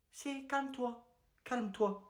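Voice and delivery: hushed self-soothing; calming down. Acted. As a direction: talk yourself down after a meltdown. Teacher calms himself down.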